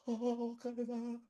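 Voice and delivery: high, slow, drawn out in fear; mock terrified moaning. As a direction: wail it like a frightened rider. Scared rider mock anthem.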